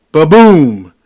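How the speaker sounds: loud mock ba-boom; bump sound effect. Mimicking the wheelchair hitting the bump, loud ba-boom. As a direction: blurt the thud of the bump.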